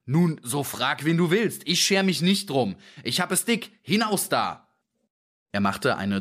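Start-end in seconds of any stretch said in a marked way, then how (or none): 0.1-4.7 high, exasperated scolding; fed-up shopkeeper losing patience; angry dismissal. A narrator's reading. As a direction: drive the pest away, pitch raised. Dealer angrily sends the man off.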